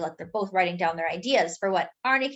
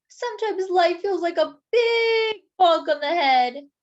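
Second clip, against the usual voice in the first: weepy; 9 semitones higher, 6 dB louder than usual. Acted. Sad donut line read.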